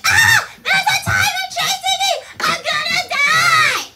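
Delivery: high, loud mock panic; panicked reptile brain. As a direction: scream like a tiger is chasing you, hammed-up. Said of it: "And it's like".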